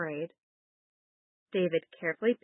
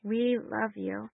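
prayer; 3 semitones higher, 3 dB quieter than usual. Acted. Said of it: "you can repeat after me".

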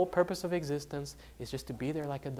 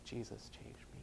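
whispered, very soft quote; hushed retelling, no sobs; retold tearful confession; 4 semitones lower, 16 dB quieter than usual. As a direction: whisper it as a broken man would. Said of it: "he started crying like a baby".

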